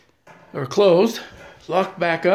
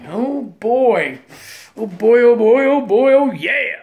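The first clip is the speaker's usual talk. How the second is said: excited; 4 semitones higher, 6 dB louder, slower than usual. Real, not acted. Thrilled at new playfield part.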